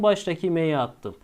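ordinary talk

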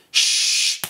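unvoiced shush for the beat; quick "shh" hiss; sh sound. Shown as a demonstration. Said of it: "make a sh sound".

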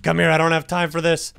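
ordinary talk

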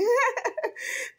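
squealing high-pitched laugh; high giddy laugh. Real, not acted. Vlogger laughing with glee at her followers. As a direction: let out a shrieking happy laugh.

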